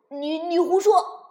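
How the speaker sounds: loud, high stammering protest; indignant retort. Acted. Little dinosaur shouting down the bragging sibling, indignant and loud. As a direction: fire back in outrage. Baby dinosaur contradicts the boast.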